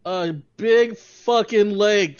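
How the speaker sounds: slow, loud stress on big; exasperated golfer stressing the huge lake; emphatic exasperation. Real, not acted. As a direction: lean hard on big fucking lake. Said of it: "exasperated emphasis".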